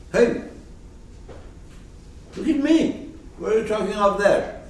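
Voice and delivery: high, loud, hurried demand; wrong, shouted challenge. Shown as a demonstration. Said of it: "he didn't say it like this".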